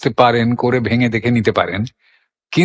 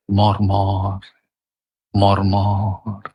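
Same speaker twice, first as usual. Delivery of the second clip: মর্মর low and evenly drawn out; soft low glide; 5 semitones lower, 3 dB quieter, much slower than usual. Shown as a demonstration. Reciter showing wind through leaves, soft gliding মর্মর. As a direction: let মর্মর roll gently in a low register, no stress. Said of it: "দুটো মোচড়ের উপর দিয়ে যেন গড়িয়ে গেল".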